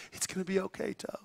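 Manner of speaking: soft, hushed reassurance to a toe; a hushed, half-crying comfort to a hurt toe; quiet comforting. Retold or imitated. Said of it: "He's cr".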